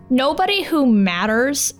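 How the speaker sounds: ordinary talk, nothing marked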